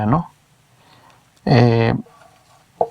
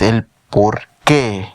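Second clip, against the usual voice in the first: El por qué drawn out slowly and low; slow emphatic; 3 semitones lower, much slower than usual. Shown as a demonstration. Teacher lingering on the meaning of the word for students. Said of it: "Es el porqué de todas las preguntas".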